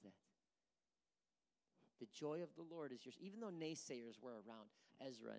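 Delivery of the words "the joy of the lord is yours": usual speaking voice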